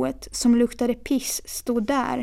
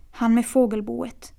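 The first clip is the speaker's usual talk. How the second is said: usual speaking voice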